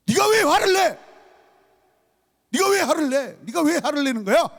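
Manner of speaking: very high, sharp mock scolding; angry reproach. Shown as a demonstration. Psalmist reproaching those who rage at God, in angry rebuke. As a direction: rebuke them with an incredulous, high-pitched demand. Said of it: "지금 책망하는 거예요".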